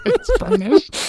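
squealing high with laughter as the snake; cracking up while voicing the snake; laughing snake voice.

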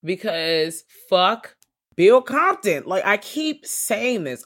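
high, loud, slow exasperated outburst; fed-up fan yelling about Bill; exasperated rant. Real, not acted. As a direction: drag Bill with loud disgust. Ranting about Bill Compton.

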